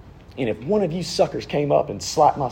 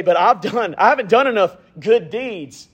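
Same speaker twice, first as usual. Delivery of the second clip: raised, higher pleading listener voice; mock objection; 8 semitones higher than usual. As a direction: play the doubting churchgoer, pitched up.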